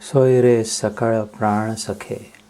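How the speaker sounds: slow abhang recital with a strong opening; verse recitation. Acted. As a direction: recite the devotional verse in drawn-out phrases. (संथ, ठाशीव अभंग पठण)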